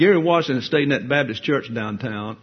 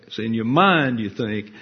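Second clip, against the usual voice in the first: ordinary talk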